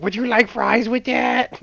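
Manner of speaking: high, slow mocking voice of the lunch lady; cafeteria lady's voice. Kids mimicking the lunch lady serving fries, in a high slow mock voice. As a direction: slowly imitate the lunch lady's odd voice. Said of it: "the voice".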